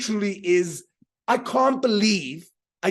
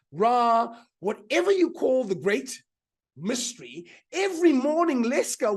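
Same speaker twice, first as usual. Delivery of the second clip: ordinary talk, nothing marked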